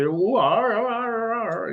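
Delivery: mock West Country yokel; West Country accent. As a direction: ham up the farmer's drawl. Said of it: "He's from, like, the West Country".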